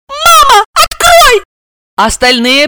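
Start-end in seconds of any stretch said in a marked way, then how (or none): 0.1-1.4 put-on very high, loud child's squeal; shrieking at the top of a little girl's voice; hysterical shriek. A narrator's reading. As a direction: shriek for mum in panic. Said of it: "истерически взвизгнула".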